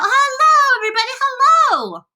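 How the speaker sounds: very high, loud joyful hail; calling out. A narrator's reading. Small boy greets the crowd below, calling out joyfully. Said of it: "laughing and waving and calling out".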